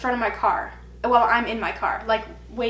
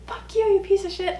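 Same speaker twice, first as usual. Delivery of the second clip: higher mock yell out the window; retold yell; 9 semitones higher than usual. Cursing the cheating ex from mom's car, mock yell.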